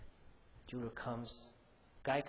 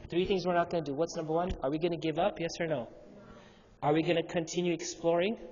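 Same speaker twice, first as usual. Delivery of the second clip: louder call and response to the crowd; rousing preaching; 3 semitones higher, 6 dB louder than usual. Acted. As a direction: fire up the congregation. Rallies the audience.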